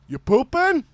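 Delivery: loud, high-pitched checking-in call; dad calling in. Retold or imitated. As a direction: ask loudly across the stalls. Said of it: "what to be the dad".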